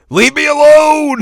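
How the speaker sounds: loud, high, drawn-out yell; sudden mock yell. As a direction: snap it out as a sudden shout. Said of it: "And then she's like".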